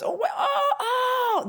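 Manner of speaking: high, laughing Oh of sudden relief; laughing realization. Retold or imitated. Viewers suddenly realizing they are not alone, laughing and high. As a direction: gasp it out as things click. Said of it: "I want other people ... to realize".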